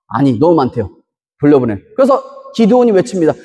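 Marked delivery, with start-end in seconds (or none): 0.1-1.8 low, flat divine command; God's voice. Retold or imitated. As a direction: drop deep and give the order as God. God's answer to Gideon.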